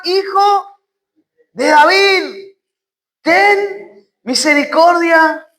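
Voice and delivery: high, long-drawn plea; crying out. Acted. Blind man calling for mercy despite rebukes, crying out. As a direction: call it out slowly, stretching the words. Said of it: "clamaba mucho más".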